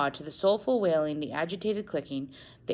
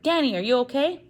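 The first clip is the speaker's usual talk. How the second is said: worried; 9 semitones higher, 6 dB louder than usual. Acted. Father rushes to fallen wife.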